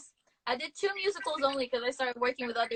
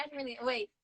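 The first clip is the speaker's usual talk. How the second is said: ordinary talk, nothing marked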